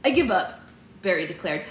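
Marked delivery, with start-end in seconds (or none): 0.0-0.6 put-on louder, higher boy declaration; exasperated brother character; other boy voice. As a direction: give up like a frustrated boy. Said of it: "This is my other boy voice".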